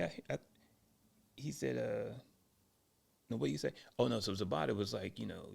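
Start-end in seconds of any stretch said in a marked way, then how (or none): none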